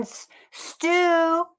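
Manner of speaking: high calling out for Stu; calling like Helen. Retold or imitated. Wife calling husband from upstairs, high drawn-out call. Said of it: "Helen was going".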